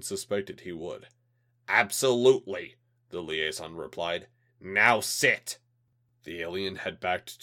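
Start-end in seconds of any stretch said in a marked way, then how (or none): 1.7-2.8 cold threatening official voice, louder; a hint of a menacing alien. Alien liaison replies. 4.6-5.6 cold threatening official voice, louder; a hint of a menacing alien. Alien liaison orders.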